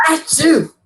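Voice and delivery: loud high mock sneeze; fake sneeze. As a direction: act out a big sneeze. Acts out sneezing in public.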